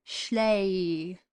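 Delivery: "Schley" with the vowel drawn out long. Shown as a demonstration. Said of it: "It's a long A".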